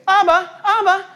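put-on high, loud child's call "Abba, Abba"; child calling daddy. Preacher imitates little kids calling their father "Abba, Abba". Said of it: "his kids will say".

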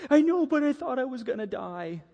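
put-on high, whiny little-boy protest; mock tearful child. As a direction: imitate a son still upset after the scare. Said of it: "he's got tears in his eyes".

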